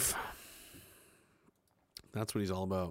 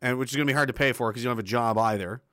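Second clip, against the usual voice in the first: normal talking voice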